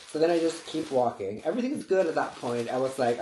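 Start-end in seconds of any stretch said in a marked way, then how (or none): none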